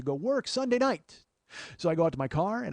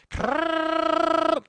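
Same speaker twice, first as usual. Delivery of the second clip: high, loud grinding krrr; grinding engine noise; 13 semitones higher, 5 dB louder than usual. Retold or imitated. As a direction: imitate a car grinding like a wood chipper. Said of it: "and it goes".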